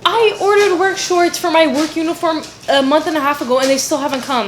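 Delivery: loud, high frustrated rant; exasperated. Real, not acted. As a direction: vent the frustration loudly. Repeating the complaint louder.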